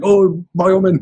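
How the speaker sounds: high, loud mock French accent. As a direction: attempt an excited Frenchman's accent. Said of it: "Frenchman, and they'll be like".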